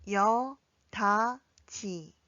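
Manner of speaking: 여다지 very slowly, pausing between syllables; drawn-out, separated 여다지 for learners to follow. Shown as a demonstration. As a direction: model 여, 다, 지 one at a time. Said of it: "함께 연습해 볼까요".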